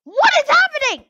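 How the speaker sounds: high, loud startled cry; surprised. Real, not acted. Player startled by sudden chaos, yelling in surprise. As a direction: shout it out in shock.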